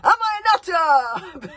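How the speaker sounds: high, loud, slow laughing bellow; bellowed boast. A narrator's reading. Chief berserk bellowing a question to his fans. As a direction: bellow it gleefully. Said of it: "bellowed the chief berserk".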